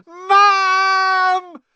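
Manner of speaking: very high, loud drawn-out wail; long panicked shriek; howling. A narrator's reading. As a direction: howl for mum in terror. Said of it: "howled Dudley".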